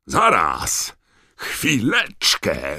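loud, slow bellowed protest; roar. Acted. Uncle Vernon roars at the wizards in the fireplace, loud slow roar. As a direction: stop them with a roar. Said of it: "ryknął".

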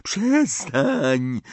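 put-on high, slow, wailing ghost girl; ghostly girl pleading. A narrator's reading. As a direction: coax him in a high wavering ghost voice. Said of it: "jęknęła jęcząca Marta".

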